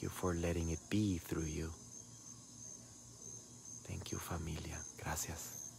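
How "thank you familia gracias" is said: breathy whispered thanks; soft whispered thank-you. Real, not acted. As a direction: whisper your gratitude to the viewers. Whispered thanks to live viewers.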